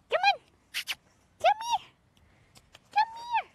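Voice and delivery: very high, loud squeaky call; high-pitched animal call. Real, not acted. Owner calling Beetle to come, high-pitched call. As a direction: call the pet in falsetto.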